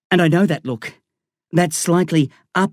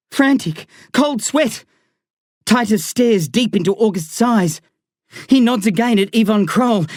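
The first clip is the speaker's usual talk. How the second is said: ordinary delivery, nothing marked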